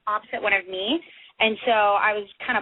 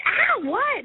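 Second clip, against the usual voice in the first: imitated high-pitched startled yelp; startled friend; 12 semitones higher than usual. Friend grabbed by the shirt from behind, startled. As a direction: react as if grabbed from behind, mildly hammed-up.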